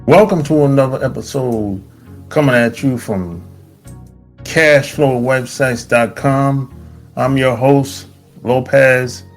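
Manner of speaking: announcer-like intro in spaced-out phrases; host introduction. Acted. Host welcoming listeners and presenting a guest, show intro. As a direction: present the guest with deliberate pauses.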